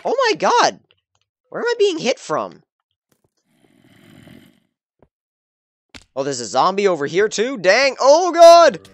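high loud panicked cries; frantic, surrounded and scared; panicking gamer. Real, not acted. Attacked from all sides.

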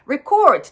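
record as re-CORD, stress on the second syllable; verb stress. Shown as a demonstration. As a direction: weaken the start and stretch the end of record. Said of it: "っていうのはこれ動詞です". (recordの後ろにストレス)

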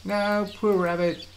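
sudden high pity for the rabbit; high pitied cry. Real, not acted. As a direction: cry out in sorry dismay. Rabbit in danger in game.

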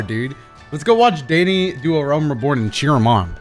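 ordinary delivery

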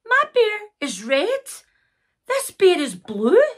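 high-pitched, protesting little voice; indignant Sarah. Acted. Sarah telling the pony it's the wrong bear, indignant. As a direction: object in a high voice.

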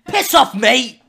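cockney geezer snarl; mock British accent. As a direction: play a mouthy London lad. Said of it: "I love when you do that accent".

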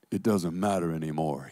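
a hint of a cold young man; lightly voiced young man. Alex brushing her off, quietly dismissive. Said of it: "he says".

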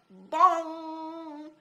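high, loud ringing bong; bong sound. A narrator's reading. Narrator imitates the helmet being struck, loud ringing bong. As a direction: ring it like a struck bell.

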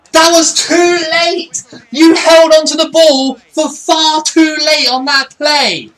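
high, slow, full-volume yell; furious shout. Real, not acted. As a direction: bellow each word in real exasperation. Furious at a late pass.

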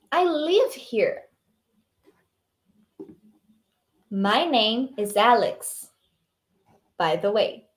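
slow dialogue line with exaggerated intonation; model dialogue reading. Shown as a demonstration. Teacher reading Alex's reply for students to repeat, slow model reading. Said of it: "tenta imitar a minha entonação".

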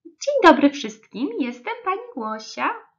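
high, singsong teacher greeting; chirpy storybook nursery teacher; twittering kindergarten teacher. A narrator's reading. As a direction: greet the class loudly and high, then ease off. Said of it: "zaszczepiotała ich nowa przedszkolanka".

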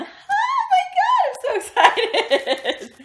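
laughing, very high, loud and slow squeal; excited squeal. Retold or imitated. Fan running up to designers, squealing with laughter. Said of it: "running up to people going".

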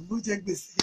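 plain reading voice

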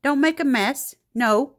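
loud, high scolding at the pet; sharp scolding. Real, not acted. Mom scolding her pet for making a mess, loud and high.

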